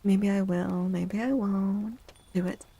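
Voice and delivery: ordinary talk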